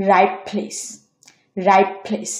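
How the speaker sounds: রাইট with final t turned to p before প্লেস; assimilated T. Shown as a demonstration. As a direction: say রাইট as "raip", running into প্লেস. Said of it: "এটা উচ্চারণ হবে".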